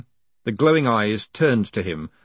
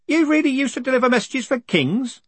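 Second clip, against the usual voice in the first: put-on much higher, eager old man. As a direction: raise the pitch for an old postman. Groat voiced high.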